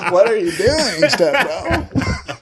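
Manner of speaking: cracking up while teasing step bro; laughing. Real, not acted.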